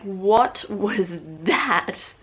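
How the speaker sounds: drawn-out, slow, baffled question; appalled friend. Retold or imitated. Friends reacting to her accent, slow appalled disbelief. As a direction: stretch each word in disbelief. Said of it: "My friends look at me like".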